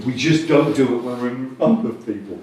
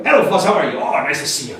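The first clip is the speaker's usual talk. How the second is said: affected voice; faster than usual. Acted. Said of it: "someone who's got really, you know, effective voice".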